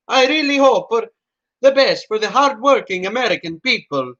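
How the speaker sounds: pompous leader's speech in a mock Italian accent; Mussolini impression in a mock Italian accent. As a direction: play Mussolini addressing Americans in a hammy Italian accent. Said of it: "His accent is terrible".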